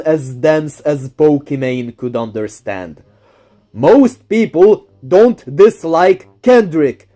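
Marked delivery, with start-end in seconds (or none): 3.7-7.1 slow, deliberate explaining; slow and careful. Shown as a demonstration. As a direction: spell it out slowly like to a child. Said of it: "explain this slowly and carefully".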